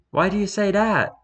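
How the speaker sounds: high, squeaky mock yell; high-pitched yell. A narrator's reading. Starpaw questioning Graystripe about his remark, high-pitched yell. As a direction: raise it high and yell lightly. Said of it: "yelled Starpaw".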